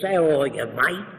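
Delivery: put-on Aussie greeting; hammed-up Australian accent. Shown as a demonstration. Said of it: "If he's brought up in Australia".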